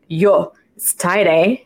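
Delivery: imitating a South African Afrikaans chiropractor, slowed; light Afrikaans accent, drawn out a touch. Retelling the chiropractor's words to the host in a light Afrikaans accent. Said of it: "in his Afrikaans accent".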